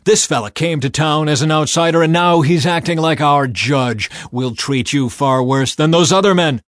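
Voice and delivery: loud, high bark of a command; threatening shout at the door; angry shout. A narrator's reading. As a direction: shove the words at him. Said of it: "they shouted".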